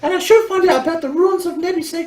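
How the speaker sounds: high, put-on girlish voice; high-voiced, questioning character; game girl character. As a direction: pitch it way up for a doubting girl.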